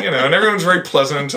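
ordinary delivery

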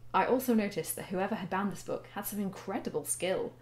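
put-on reading her teenage story aloud, steady and quiet. A grown woman reads her old story to viewers, in a steady narrating voice. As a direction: narrate it like a book being read out.

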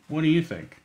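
ordinary delivery, nothing marked

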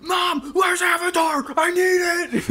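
put-on teen bursting through the door shouting for a movie; frantic shout. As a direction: yell up at mom like it's an emergency. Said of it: "I was like".

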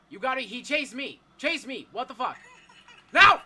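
high, loud panicked outburst; frantic scared shouting; panicking gamer. Real, not acted. Chased again by monster.

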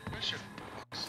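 sneaky unvoiced whisper. Real, not acted. Streamer sneaking past the monster, whispering. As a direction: hush it like someone could hear. Sneaking quietly through horror game.

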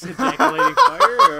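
loud, high laughing question. Real, not acted. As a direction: ask it while cracking up. Laughing while asking.

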